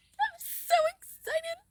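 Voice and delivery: high, gushing excitement over a dream month; excited. Shown as a demonstration. Coach showing students how a desire should feel, gushing excitedly. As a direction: squeal with joy at the thought. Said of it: "can you feel the excitement in my voice".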